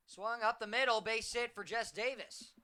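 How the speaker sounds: loud, high play-by-play call; excited hit call. Real, not acted. Commentator calls a base hit.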